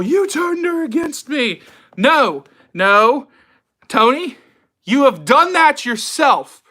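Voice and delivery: high, slow, shouted accusation; dramatic movie quote. Retold or imitated. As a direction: hurl the line like a wounded lover. Quoting a dramatic movie line.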